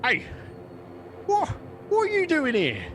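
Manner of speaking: high-pitched goblin challenge; goblin leader. Acted. Goblin confronting sneaking adventurers at the camp, high goblin voice. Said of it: "the larger goblin runs out".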